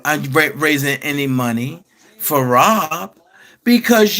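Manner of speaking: deep, deliberate interviewer reproach, the name dropping very low; Gayle King voice. Acted. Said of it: "In my Gayle King voice".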